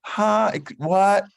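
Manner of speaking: high, squeaky mock voice of a puzzled listener; confused listener. People who couldn't understand his island accent, squeaky mock confusion. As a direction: pitch it way up like someone who can't follow his accent. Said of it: "I got tired of people saying".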